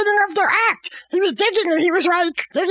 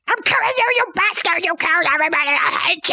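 hammed-up garbled killing yell; mock rage; faster than usual. Hamlet stabs the king, mock rage.

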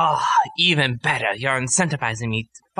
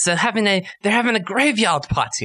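gushing delight; 8 semitones higher than usual. Acted. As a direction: gush over the party. Alchemist gushes about the funeral.

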